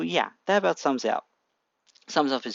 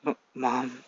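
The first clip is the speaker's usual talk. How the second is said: muttered; 5 dB quieter than usual. A narrator's reading. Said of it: "he mutters".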